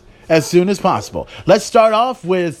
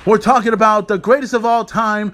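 normal talking voice

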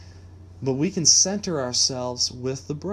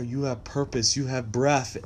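ordinary talk, nothing marked

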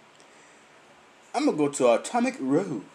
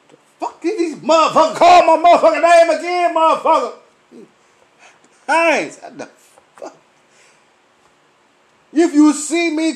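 loud, high, fed-up tirade; angry rant; 9 semitones higher, 8 dB louder than usual. Retold or imitated. Ranting at coworkers who keep calling his name, loud and angry.

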